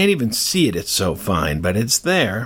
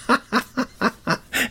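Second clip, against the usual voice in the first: high laugh; 12 semitones higher than usual. Real, not acted. Laughs at own digression.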